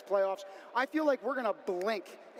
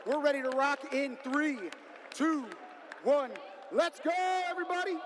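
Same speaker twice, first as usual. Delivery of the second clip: rising excited countdown into a shout; hyped countdown; 5 semitones higher than usual. Real, not acted. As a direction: build it up and shout the go. Announcer countdown to match start.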